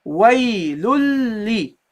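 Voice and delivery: "Waylul li" slowly, long l held into li; doubled lam. Shown as a demonstration. Teacher pronounces second lam with kasra, doubled l. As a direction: hold the l of "Waylul li" across both syllables. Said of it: "you pronounce it with a kasra".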